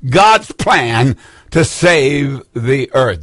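"God's plan to save the earth" stretched out slowly, each word given its own weight; solemn slowed-down restatement; slow and weighty. Shown as a demonstration. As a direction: drag it out slowly so it sinks in. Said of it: "That's the subtitle".

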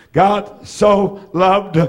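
each word drawn out; slow savoring of the verse; slow emphatic. Real, not acted. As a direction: linger on every word. Slow repeated text.

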